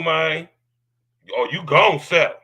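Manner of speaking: normal talking voice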